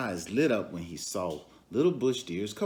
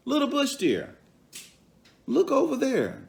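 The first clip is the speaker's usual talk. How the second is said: much higher, coaxing trickster; Anansi scheming; 14 semitones higher, 4 dB louder than usual. Acted. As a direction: lure her to look, high and sly. Anansi springs his trick.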